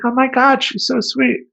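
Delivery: put-on high, gushing girly voice; gushing girlfriend. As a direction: coo over the cute rat. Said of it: "she's like".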